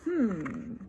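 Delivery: ordinary delivery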